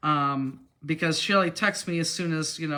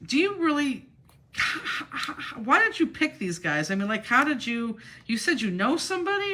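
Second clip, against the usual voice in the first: high, stammering disbelief in the trainer's voice; incredulous trainer; 8 semitones higher than usual. Retold or imitated. Trainer asking why she picked these drivers, high and incredulous. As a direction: play the trainer stunned by her choice. Trainer's alarmed text retold.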